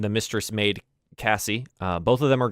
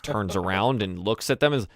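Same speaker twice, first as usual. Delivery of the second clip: ordinary delivery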